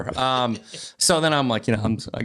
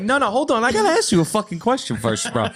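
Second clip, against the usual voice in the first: pushy guy; 10 semitones higher than usual. Retold or imitated. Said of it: "He'd be like".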